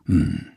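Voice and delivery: viel tiefer, knappes Brummen; tiefes Brummen. Acted. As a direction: nur ein tiefes „Hm“ von sich geben. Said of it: "macht Opa". (Opa antwortet wortkarg mit einem tiefen Brummen)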